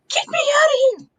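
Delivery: high-pitched imitation of panicked screaming; mock scream. Fan imitates Bianca trapped in the pod, mock screaming. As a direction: squeal it like someone trapped, hammed up. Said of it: "She's screaming".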